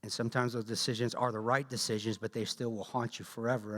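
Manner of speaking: ordinary talk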